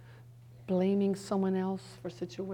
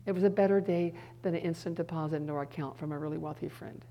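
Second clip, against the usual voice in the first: usual speaking voice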